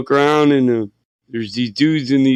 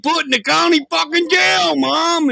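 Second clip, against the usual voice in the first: much higher furious shout; shouting at mom; 13 semitones higher than usual. Retold or imitated. On the bar payphone blaming his mom, a full shout. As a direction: yell it over the bar music. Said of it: "And I'm yelling".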